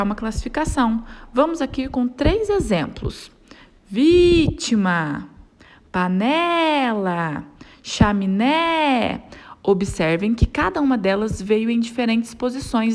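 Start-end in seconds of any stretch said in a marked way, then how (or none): 3.9-5.3 vítima with VI stretched long; calling the word. Shown as a demonstration. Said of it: "chamando a palavra". 5.9-7.4 panela with NE held very long; calling the word. Shown as a demonstration. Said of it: "chamando a palavra". 7.8-9.2 chaminé with a long final NÉ; calling the word. Shown as a demonstration. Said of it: "chamando a palavra".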